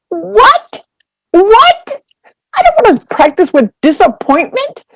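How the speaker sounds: high, rising, incredulous what; indignant what. Retold or imitated. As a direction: shoot up in outraged disbelief. Reacting to the monk's advice.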